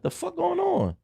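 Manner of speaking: very high confused cry; alarmed disbelief. Retold or imitated. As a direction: squeak it up in shock. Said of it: "I'm like".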